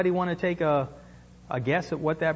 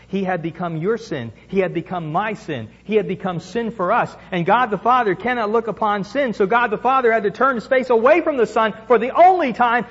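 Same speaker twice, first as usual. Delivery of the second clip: normal talking voice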